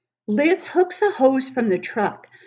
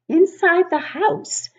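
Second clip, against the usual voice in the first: much higher, ominous lift; dramatic high opener; 11 semitones higher, 4 dB louder than usual. A narrator's reading. Dramatic turn in story.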